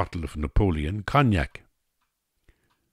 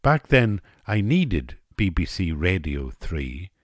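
ordinary delivery, nothing marked

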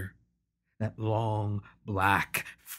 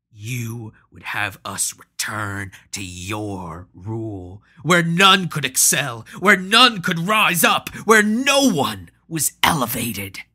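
angry; 7 dB louder than usual. Acted. Hanno rails at Patro.

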